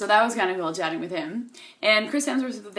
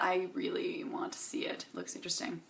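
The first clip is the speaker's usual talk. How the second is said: soft breathy whisper to the camera; 3 semitones lower, 12 dB quieter than usual. Real, not acted. Vlogger confiding she wants to see the movie, whisper. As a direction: lean in and whisper it like a secret.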